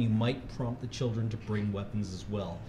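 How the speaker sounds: ordinary talk, nothing marked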